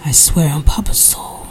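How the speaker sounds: hushed whispered oath; whispered vow. A narrator's reading. Rufus swearing to his mother, whispered vow.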